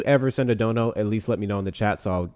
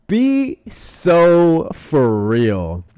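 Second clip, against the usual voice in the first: loud, high, very slow disbelief; long exasperated wail of words; drawn-out exasperation; 8 semitones higher, 8 dB louder, much slower than usual. Real, not acted. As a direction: stretch every word out in outraged disbelief. Repeats it, far more drawn out.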